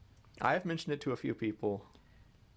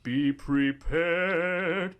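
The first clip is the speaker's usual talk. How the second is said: ominous warning; 5 semitones higher, 4 dB louder, much slower than usual. Acted.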